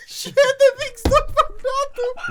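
squealed, very high exclamation, drawn out; slow, high wail; high-pitched outcry. Real, not acted. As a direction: cry it out slowly in a squeaky high voice. Mock outcry at the solver's mistake.

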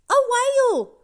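high, loud and eager; excited girl. Acted. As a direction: announce it with delight. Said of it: "Doc says".

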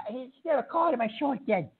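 normal talking voice

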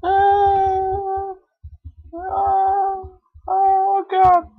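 shrieking in alarm; high-pitched panic. Real, not acted. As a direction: squeal high and frantic. Character falling, panic.

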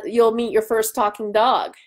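usual speaking voice

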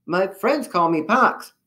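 slow, high, friendly little character; smiling young germ. A narrator's reading. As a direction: say it sweetly through a smile.

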